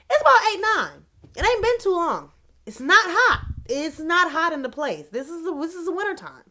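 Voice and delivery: high, loud, baffled insistence; insistent protest. Retold or imitated. Telling listeners it can't be the heat, insistent protest. Puzzled protest while retelling her morning.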